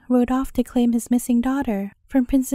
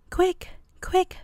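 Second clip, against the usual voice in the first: higher-pitched, hushed urgency; urgent cry; 5 semitones higher than usual. A narrator's reading. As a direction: urge them on in a high, contained voice. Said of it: "cried the king and Bobo".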